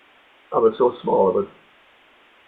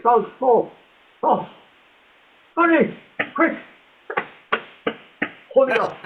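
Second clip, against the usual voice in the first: high, urgent mock call for help; calling out in a panic to the mate; retold urgent calling; 11 semitones higher than usual. Bob calling his mate over in a panic, high and urgent.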